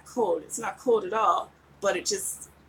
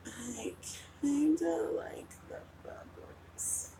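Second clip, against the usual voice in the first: breathy whispered aside; soft secret whisper; 6 semitones higher, 12 dB quieter, slower than usual. Real, not acted. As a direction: whisper it close to the mic. Whispering over bubble filter.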